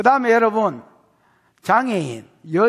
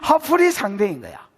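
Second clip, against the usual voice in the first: ordinary delivery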